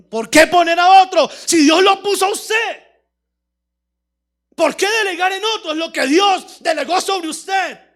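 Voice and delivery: loud, driving sermon delivery; preaching at full force. Real, not acted. Preacher rebuking the paralytic's passivity, full-force preaching. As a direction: hammer the key words, preacher-style.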